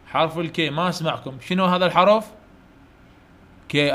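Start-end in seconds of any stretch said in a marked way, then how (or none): none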